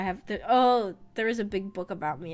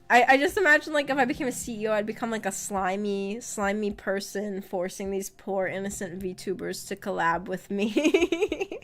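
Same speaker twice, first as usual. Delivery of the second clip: ordinary delivery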